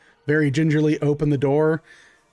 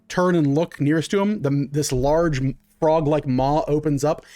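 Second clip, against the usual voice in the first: ordinary talk, nothing marked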